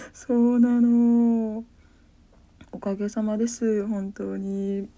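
slow, loud, drawn-out words. Pretend. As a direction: stretch every syllable out. Drawn-out mock grateful reply.

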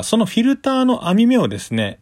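ordinary talk

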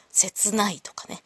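normal talking voice

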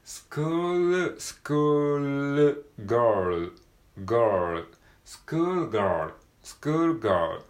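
teacher's pronunciation drill. Shown as a demonstration. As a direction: stress the L and R in スクールガール. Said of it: "エルアールエルという風に".

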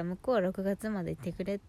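ordinary talk

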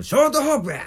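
put-on gruff old man bark. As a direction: bark ショートホープ at the clerk. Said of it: "みたいなこと言うてきて".

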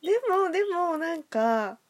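ordinary delivery

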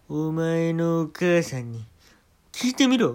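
slow, then a high loud bark; loud rude command. Acted. Comic repeats the rude command to the manager, booming. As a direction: bark it down at him. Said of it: "って言って".

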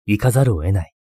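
usual speaking voice

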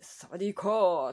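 a burly policeman's booming "サワディーカー"; imitating the policeman's cheerful shout; big loud greeting. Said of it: "大きい声で".

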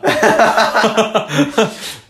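high loud laughing fit; loud burst of laughter. Real, not acted.

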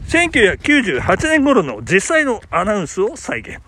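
normal talking voice

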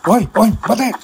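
high shout to stop him. Acted. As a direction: yell after a runaway. Officer chasing fleeing man.

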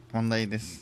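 normal talking voice

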